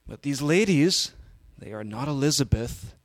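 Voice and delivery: wrestler promo rising to a loud high finish; Macho Man. Acted. Player lamenting Elizabeth to Mean Gene, swelling wrestler impression. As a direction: hold back, then burst upward in wrestler voice. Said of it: "we're gonna do this Macho Man cast".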